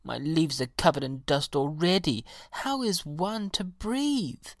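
high-pitched, fretful grumble; grumbling. A narrator's reading. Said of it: "grumbled the pansy".

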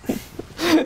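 a quick amused laugh; a bright little burst of laughter; short laugh. Real, not acted. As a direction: laugh it off briefly. Laughs after saying "no.

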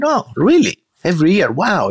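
high-pitched amazed questioning; retold surprise. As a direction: react high, impressed by the news. Retold amazement at yearly trips.

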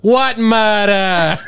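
slow, drawn-out New York tough guy; New York accent. Retold or imitated. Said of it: "Like those guys on the street".

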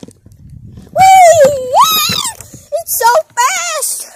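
high, loud slide squeal. Acted. Newcomer riding down the fast slide, loud squealing. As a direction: squeal the words out, stretched long.

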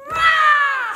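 high, loud war cry; really mean the rah; full shout. Shown as a demonstration. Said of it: "There we go".

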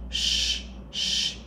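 sh hushed with rounded lips; a soft hushing sh; sh sound. Shown as a demonstration. Teacher showing the sh, like hushing a child to pee. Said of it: "người ta hay làm kiểu sh".

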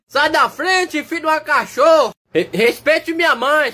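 high, yelled insult; angry shout. Acted. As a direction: bark it out to clear the way. Dog shouting at blocking dog.

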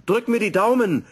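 high, loud call to his wife. Acted. Professor calls out to his wife before takeoff, high and loud. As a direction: shout it back as you leave. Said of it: "rief er seiner Frau noch zu".

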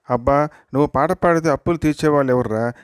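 ordinary delivery

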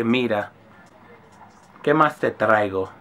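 low, soft, honeyed Spanish flattery, a mock waitress; sweet-talking waitress. Comedian imitating a flirty Dominican waitress, low and soft, sweet-talking. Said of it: "And she gonna say".

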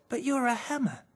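a puzzled little bear; a shy little bear, puzzled by the hammer; little teddy bear. A narrator's reading. Voices Green, small bear.

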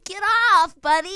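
high, loud acted plea; mock-panicked, friendly protest; retold plea to dog. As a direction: fend off a dog you love. Retold pleading with biting dog.

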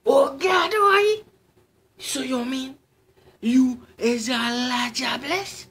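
panicked high scream; horrified shout. Acted. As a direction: cry out in horror to God. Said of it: "The horrified man shouted".

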